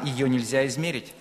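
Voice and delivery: usual speaking voice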